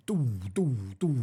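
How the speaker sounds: drum fish call. Shown as a demonstration. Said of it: "like that".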